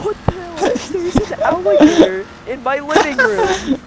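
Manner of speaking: high, loud mock outrage; loud, incredulous exclamation; shocked disbelief. Retelling shock at seeing alligator.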